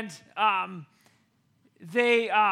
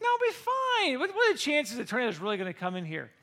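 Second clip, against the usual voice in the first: dismissive dad; 2 semitones higher, faster than usual. Retold or imitated. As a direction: imitate a dad breezily waving off worry. Dad waves off mom's worry.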